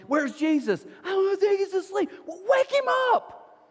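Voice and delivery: high-pitched frantic shouting; panicked yelling. Acted. Disciples yelling on a sinking boat, panicked high shouts. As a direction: yell over the storm, pitch climbing sharply. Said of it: "they're all yelling".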